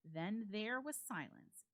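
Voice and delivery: usual speaking voice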